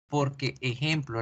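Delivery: ordinary delivery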